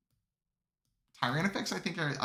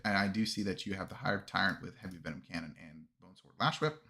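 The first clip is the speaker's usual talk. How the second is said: ordinary talk, nothing marked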